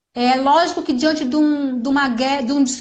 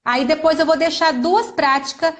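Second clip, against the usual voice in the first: ordinary talk, nothing marked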